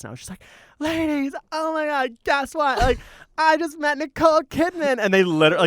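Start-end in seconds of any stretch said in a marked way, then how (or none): 0.8-5.0 high-pitched gleeful announcement; re-enacted high, excited boy; thrilled kid. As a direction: squeal the big news to a group. Thrilled kid addressing bachelorette party.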